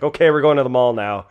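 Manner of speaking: imitating a friend, loud and high; loud announcement. Friend rallying the group at the bar, loud announcement. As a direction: call it out over everyone like the friend did.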